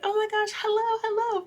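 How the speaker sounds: high, loud gushing hellos; everybody in the room greeting the trainer; excited hello. Retold or imitated. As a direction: greet her back with delight. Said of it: "everybody's just like".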